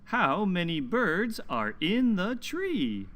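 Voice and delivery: slow, lilting picture-book reading; reading to children. Acted. Teacher reading a story aloud to children, slow and lilting. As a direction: read it slowly to little listeners, leaning on the numbers.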